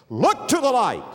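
loud, high repeated exhortation; urgent call. Acted. Preacher repeating his command to the congregation, loud call.

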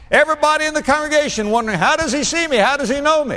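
high-pitched, quick awe; high astonished wonder. Retold or imitated. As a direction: sound amazed and rushed. Congregation's wonder acted out.

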